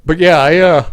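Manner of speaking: talking through a yawn; words stretched by a yawn. Real, not acted. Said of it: "again, another yawn".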